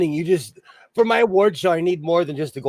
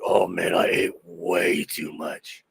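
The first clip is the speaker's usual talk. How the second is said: low, slow, overfed groan; overstuffed groan; 10 semitones lower, 3 dB quieter, slower than usual. Retold or imitated. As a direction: moan like you ate far too much. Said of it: "I'm like".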